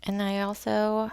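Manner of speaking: ordinary delivery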